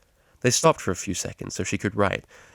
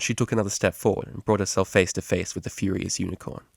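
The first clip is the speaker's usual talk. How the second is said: usual speaking voice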